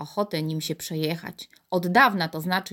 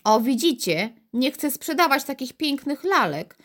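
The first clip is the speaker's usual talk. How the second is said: mildly irritated; 7 semitones higher than usual. A narrator's reading. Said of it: "zaczęli się irytować".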